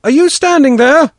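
high, loud hallooing; shouted call. Acted. Father calls his lost daughter through the reeds, loud halloo. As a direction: call out far across the thickets. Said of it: "I shouted".

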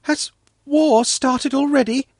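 put-on high, anxious little-girl question; worried child, pitched well up; sinking voice. A narrator's reading. Svetlana asking about the gunfire, high voice of mild dread. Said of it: "asked in a sinking voice".

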